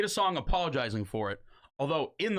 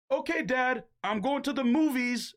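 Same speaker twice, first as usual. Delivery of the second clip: put-on higher, light teen daughter; daughter's voice; 9 semitones higher than usual. A lightly voiced daughter telling [name] she's leaving. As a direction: breeze out the door to dad.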